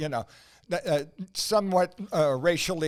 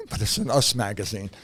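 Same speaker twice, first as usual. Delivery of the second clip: normal talking voice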